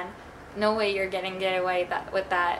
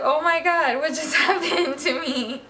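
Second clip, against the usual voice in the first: crying laugh; 6 semitones higher, 5 dB louder than usual. Retold or imitated. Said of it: "I was like".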